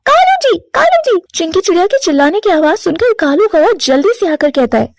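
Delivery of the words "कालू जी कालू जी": high, slow, drawn-out calls of his name; yelling for her husband. Acted. Chinki screaming for her husband over the letter, high drawn-out yell. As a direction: shout his name across the house. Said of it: "जोर जोर से चिल्लाने लगती है".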